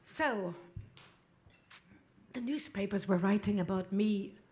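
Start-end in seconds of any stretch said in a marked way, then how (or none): none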